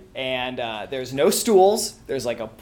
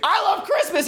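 put-on a drunk guest matching the bartender's joy, shouting; excited shout. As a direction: yell it with giddy glee. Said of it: "And I'm like".

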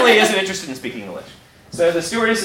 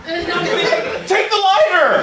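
high, sharp call; urgent command; 13 semitones higher than usual. Retold or imitated. Stewardess to the drunk passenger, urgent high call. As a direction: snap it out like a panicked stewardess. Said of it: "the stewardess is like".

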